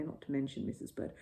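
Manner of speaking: ordinary delivery, nothing marked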